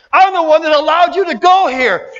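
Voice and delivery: ordinary talk, nothing marked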